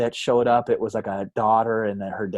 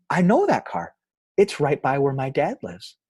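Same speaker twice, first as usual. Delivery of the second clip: put-on high-pitched small child's voice; five-year-old kid; 5 semitones higher, 3 dB louder than usual. Five-year-old telling about the old car, high child voice. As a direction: imitate a little kid piping up. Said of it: "had to have been five years old".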